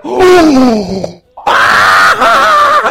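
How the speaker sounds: loud "oh" into very high shriek; exaggerated high, drawn-out scream; mock crowd outcry. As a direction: imitate kids recoiling, then shrieking. Crowd reacting as he stands.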